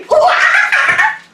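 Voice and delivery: very high loud monkey whoop; high loud laughing monkey call; monkey cry. Shown as a demonstration. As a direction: leap and shriek like a startled monkey. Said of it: "show me your monkey noises".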